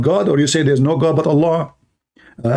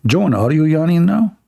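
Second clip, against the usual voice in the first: normal talking voice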